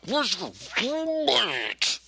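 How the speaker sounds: high, slow put-on cop; mocking the officer's voice; silly cop voice. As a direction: drag out the cop's question in a goofy voice. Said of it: "Why are you talking like that?".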